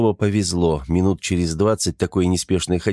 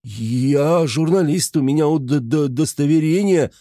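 frightened stammer; 7 semitones higher than usual. A narrator's reading. Scared man stammers at gunpoint.

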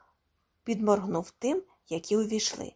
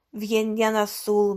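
ordinary delivery, nothing marked

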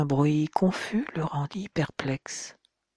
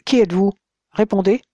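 loud, higher demand; frightened boy demanding an answer, loud; louder demand; 4 semitones higher, 7 dB louder, faster than usual. A narrator's reading. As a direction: shout the challenge into the dark. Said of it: "reprit plus fort".

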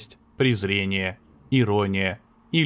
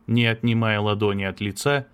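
ordinary delivery, nothing marked

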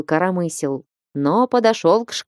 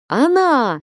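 not-his-own cry; 7 semitones higher than usual. A narrator's reading. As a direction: blurt it out in a voice not your own, high. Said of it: "крикнул он не своим голосом". (вскрик не своим голосом)

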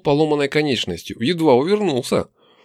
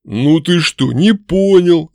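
loud, menacing orc growl; 4 semitones higher, 7 dB louder, slower than usual. A narrator's reading. Orc threatening a stubborn captive, loud growl. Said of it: "зарычал орк".

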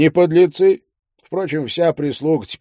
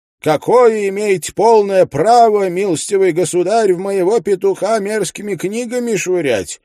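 high, loud outraged scolding; indignant widow; 8 semitones higher, 4 dB louder than usual. A narrator's reading. Widow scolds narrator over her rooster, high indignant voice. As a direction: bristle at him like an offended landlady. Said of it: "говорит".

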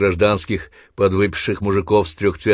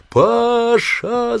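very high, loud long-distance call; 3 dB louder than usual. Acted. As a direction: shout through cupped hands. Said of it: "закричал он, сложив ладони рупором".

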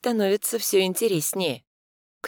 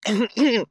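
awkward throat clear; 7 semitones higher, 4 dB louder than usual. Acted. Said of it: "откашливается".

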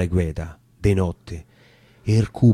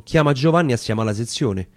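plain reading voice

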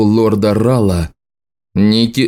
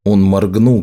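ordinary delivery, nothing marked

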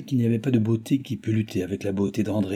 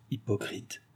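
murmured; 9 dB quieter, slower than usual. A narrator's reading. Maid to herself about her mistress, murmured. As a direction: mutter it softly under your breath. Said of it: "murmura Nicole".

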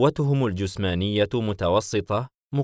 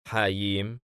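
plain reading voice

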